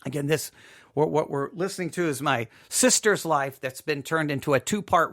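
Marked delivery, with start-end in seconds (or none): none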